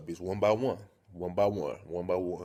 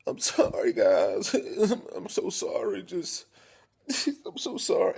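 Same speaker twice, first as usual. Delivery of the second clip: high-pitched, hammed-up sobbing apology; whimpery fake cry broken by sobs; mock crying; 11 semitones higher than usual. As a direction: imitate a girlfriend blubbering an apology. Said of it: "she comes in crying and shit".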